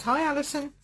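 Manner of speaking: ordinary talk, nothing marked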